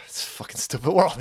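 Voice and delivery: usual speaking voice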